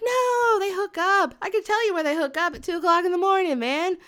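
high "no", then rapid explanation; emphatic insistence. Retold or imitated. Guys confirm what bushwandas do, emphatic high insistence. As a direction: imitate a guy eagerly insisting.

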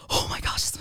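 breathless mock whisper of dread; whispered panic. Student caught passing a note whispers in panic. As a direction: hush it like he's right behind you. Panicking as teacher looms behind.

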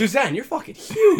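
high-pitched mock praise, loud. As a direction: squeal it out in mock awe. Voicing movie characters mockingly.

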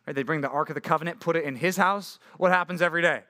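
ordinary delivery

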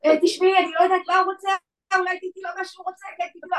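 high-pitched, mocking imitation of a hapless officer; flustered policewoman. Policewoman begs her to give him what he wants, flustered. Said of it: "באה שוטרת כולה ככה".

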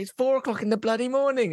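high-pitched exasperated protest; protesting dad. Acted. Dad phoned at night for a lift, high exasperated protest.

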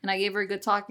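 ordinary talk

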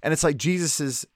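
usual speaking voice

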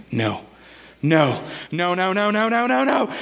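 spiral from dread into panic, pitch rising each time. Retold or imitated. Realizing assembly mistake.

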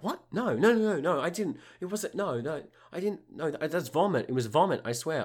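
high, squeaky panicked denial; shrill frantic no no no; protesting high voice. Retold or imitated. Sally denies the poo.

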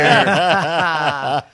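hearty laughing; loud laugh. Real, not acted. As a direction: burst of real laughter. Listener laughing.

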